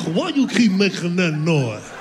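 slow, distorted choking caricature; hammed-up throaty noise voice; stuck-in-the-throat voice. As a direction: force the words through a blocked throat. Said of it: "got something stuck in my throat".

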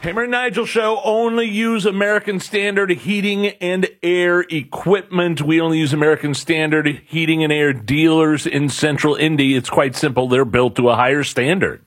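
high, quick start to a sponsor plug; radio ad read. Acted. Co-host plugging a heating sponsor to listeners, ad read. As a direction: sell it like a radio announcer.

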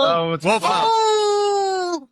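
howling like a wolf on request. Shown as a demonstration. Premier howls when asked on the podcast, long wolf howl. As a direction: throw your head back and howl. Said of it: "Can you do that coyote howl for us?".

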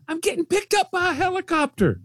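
squealing high with excitement; excited. Real, not acted. Thrilled at helicopter ride idea.

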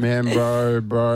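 lightly mocked Albanian accent; drawl-free imitation of a mate's bro talk in accent; Josiah. Imitating absent co-host for the panel, mock Albanian accent. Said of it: "He's like".

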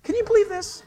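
high, disbelieving exclamation; incredulous. Real, not acted. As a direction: marvel at the foolishness. Incredulous aside.

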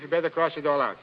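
ordinary delivery

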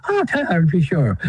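an old Irish priest's brogue, lightly imitated; mock Irish priest, pitched high at the opening; Irish accent. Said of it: "He spoke, he spoke like that".

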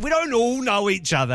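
mock protest. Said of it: "We always say".

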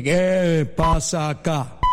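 slow, drawn-out devil voice; Lucifer. Acted. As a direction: boom it out slowly as an annoyed lord of hell. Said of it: "apareció Lucifer". (voz de diablo, lenta y arrastrada)

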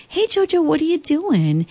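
high sing-song call to a little one; mother to small child. Retold or imitated. Mother greeting her little son, retold.

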